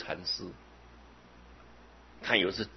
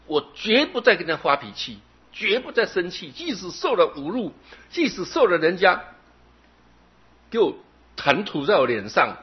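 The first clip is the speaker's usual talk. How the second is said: high, loud, eager promise; fervent vow; 9 semitones higher, 6 dB louder than usual. Retold or imitated. Young hothead promising his teacher, loud and eager. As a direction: swear it to the master with fire in your voice. Young man vows to master.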